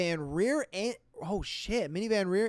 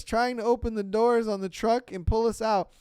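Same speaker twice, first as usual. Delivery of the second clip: ordinary talk, nothing marked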